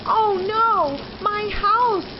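high, loud cry of dismay; yelling. A narrator's reading. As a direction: yell as the house falls. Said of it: "yelled Beaver".

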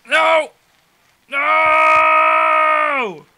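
high loud drawn-out no; shouting no. Real, not acted. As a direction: scream it in dismay. Failing the level.

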